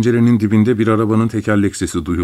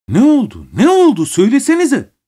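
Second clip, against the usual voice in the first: much higher and louder cry; anguished shout; 5 dB louder than usual. A narrator's reading. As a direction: shout it out in distress. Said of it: "Çok üzgün haykırdım". (acı içinde haykırış)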